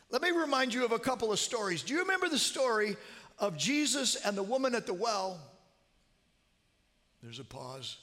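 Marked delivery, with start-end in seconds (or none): 0.1-5.4 fast, loud and high reminder; loud, fast urging. Retold or imitated. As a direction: hurry the words out loudly. Calling to deaf mother-in-law.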